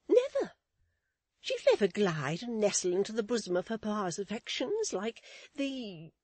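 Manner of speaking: high, loud, insistent declaration; emphatic matron. Acted. Mrs Chick despairs of Florence, emphatic. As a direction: start on a high burst and keep it lofty.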